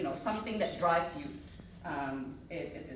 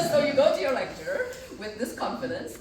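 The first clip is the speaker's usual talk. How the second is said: laughing through the words, louder and higher; 9 semitones higher, 11 dB louder than usual. Real, not acted. Speaker laughing at how confident they were as a student. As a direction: chuckle at your younger self while telling it.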